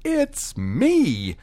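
high, bright cheerful voice; fairy godmother. A narrator's reading. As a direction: chirp it like a fussy fairy. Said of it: "And the lady said".

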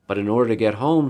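ordinary delivery, nothing marked

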